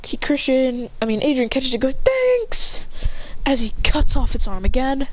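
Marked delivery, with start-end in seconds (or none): 2.0-2.8 high-pitched, hurried thanks; acted battle shout, pitched up; called-out thanks. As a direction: call back to a teammate. Hero catches thrown sword mid-fight.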